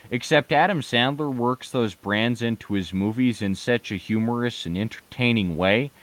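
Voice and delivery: ordinary delivery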